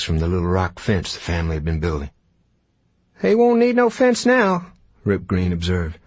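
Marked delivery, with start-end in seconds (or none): 3.2-4.7 much higher, reedy ranger voice; high-pitched cowboy voice. Acted. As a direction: squeak it out like a scrawny cowpoke. Rip Green voiced in story.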